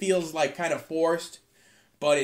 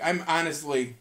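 normal talking voice

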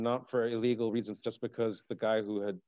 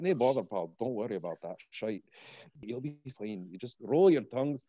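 put-on Scottish accent. A Scottish pal reassuring a podcast host that he'll be fine, in a Scottish accent. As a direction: reassure a friend in a rolled-R Scottish brogue. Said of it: "the tongue rolling shit".

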